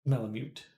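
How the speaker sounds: Malamute ending in "myoot"; "mute" like muting. Shown as a demonstration. Streamer trying out the suggested way, Malamute with "myoot". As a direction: put a y-glide in Malamute, as in mute. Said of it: "Like you'd mute the sound".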